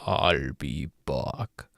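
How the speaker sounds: Terminator impression. Slower deeper second take.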